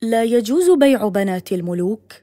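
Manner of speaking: loud, forceful narrated objection; protesting cry. A narrator's reading. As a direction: call out in firm protest. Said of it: "هتف الرجل معترضا رغم غضب الخليفة". (هتاف اعتراض بصوت عالٍ)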